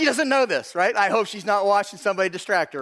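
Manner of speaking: ordinary talk, nothing marked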